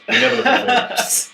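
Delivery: high, loud comic exclamation; jokingly insisting to the listeners, high and loud; mock outburst. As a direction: blurt it out high and loud as a joke. Joking exaggerated denial.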